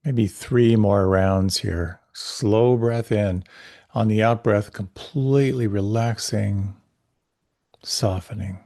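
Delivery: calm, unhurried meditation voice; guided meditation. Acted. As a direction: guide the listener gently inward.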